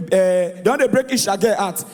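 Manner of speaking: normal talking voice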